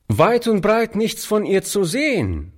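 light call across the street. A narrator's reading. Street boy to the old man opposite, calling out. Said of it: "rief der Junge dem alten Mann zu".

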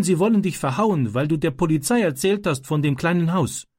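Ram telling. A narrator's reading. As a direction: report the bullies' threat earnestly. Ram tells what happened.

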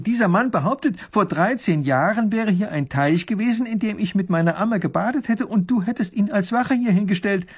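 lightly voiced princess, high pitched; agitated princess. Princess running to her father, agitated and high. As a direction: pour out the complaint.

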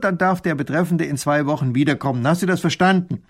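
loud, high, insistent explaining; exasperated lecture. A narrator's reading. Minister tells the woman his procedure, exasperated. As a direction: lay down the rules impatiently.

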